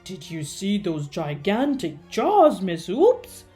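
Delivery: loud, defensive question; incredulous exclamation. A narrator's reading. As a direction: exclaim it, still spooked. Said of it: "he exclaimed".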